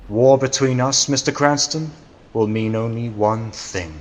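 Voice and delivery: loud, high threatening cry; menacing threat. Acted. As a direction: hurl the threat at him. Villain threatens the Shadow.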